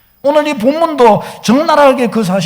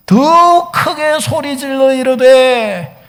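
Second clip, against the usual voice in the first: 더 크게 stretched out slowly and hard; slow, drawn-out proclamation of 더 크게; emphatic repetition; 2 semitones higher, much slower than usual. Real, not acted. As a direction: hammer home 더 크게, each word held long. Repeats verse phrase for emphasis.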